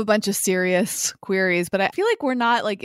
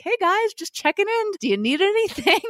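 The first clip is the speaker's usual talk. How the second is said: put-on high, singsong dad checking in on the kids; happy-go-lucky Midwestern dad; 12 semitones higher than usual. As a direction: imitate a cheery sketch-show dad, pitched way up. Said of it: "the happy-go-lucky Midwestern dad".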